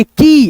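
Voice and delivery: की with a stretched ee; slow, lengthened की as a reading demo; drawn-out long vowel. Shown as a demonstration. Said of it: "ऐसे करके".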